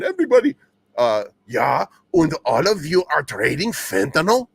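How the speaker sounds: loud, hammed-up German accent; mock German-accented proclamation; Liz Warren, German accent. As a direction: put on a bad, booming German accent. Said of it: "I can't do the German accent".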